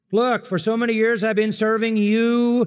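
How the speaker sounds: loud, high resentful whine; older son resenting his father; bitter complaint. Acted. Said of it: "It's bitter".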